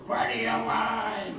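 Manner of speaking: slow, lightly voiced grumbling monster; a hint of a teen gargoyle growling back; sulky monster. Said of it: "the monster inside goes".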